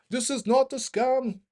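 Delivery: slow, high imitation of a thick Indian accent; exaggerated Indian accent, drawn out; Indian accent impression. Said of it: "sorry for the accent".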